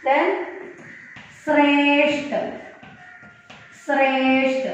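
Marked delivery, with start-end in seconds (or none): 1.4-4.6 ശ്രേഷ്ഠ with a long held first vowel; model pronunciation. Shown as a demonstration. Teacher models ശ്രേഷ്ഠ for the class, drawn-out careful syllables. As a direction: say ശ്രേഷ്ഠ carefully, pause, repeat it. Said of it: "correct pronunciation".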